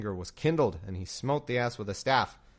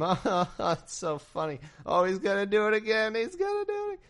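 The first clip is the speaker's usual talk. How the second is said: mock delight; 10 semitones higher than usual. Mocking audience delight.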